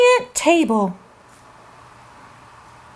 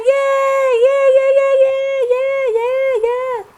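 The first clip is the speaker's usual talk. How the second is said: cheering; 8 semitones higher than usual. Acted. Host cheers show's return.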